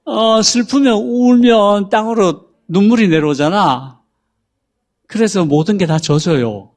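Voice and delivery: drawn-out dim-witted explanation; IQ-50 simpleton. Acted. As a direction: answer slowly like someone not very bright. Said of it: "네가 아이큐 오십인 사람이라고 생각하고".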